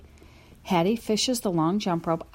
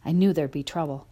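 plain reading voice

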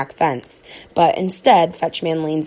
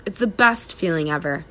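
ordinary delivery

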